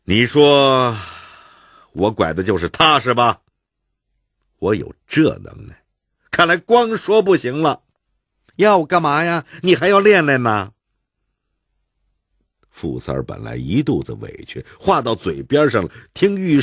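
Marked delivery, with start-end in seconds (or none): 0.0-10.7 sparring banter. Acted. Fu San and Yushu banter.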